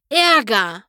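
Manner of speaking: "Ärger!" flung out as a loud outcry; "Ärger!" as an indignant cry; loud exclamation. Acted. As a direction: hit "Ärger!" hard and loud. Pony exclaims the trouble.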